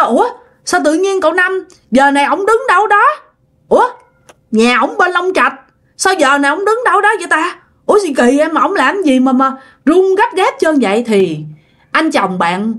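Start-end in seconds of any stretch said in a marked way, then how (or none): 0.0-11.0 puzzled wondering. Acted. Nephew puzzles over the late call.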